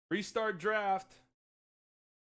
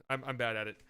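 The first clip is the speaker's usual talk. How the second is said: ordinary delivery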